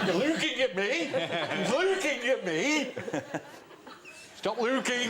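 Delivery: high, mock-indignant challenge; playful, high-pitched catchphrase delivery; comedy duo catchphrase. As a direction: pitch it up like a comic picking a fight. Acting out a comedy duo's catchphrases.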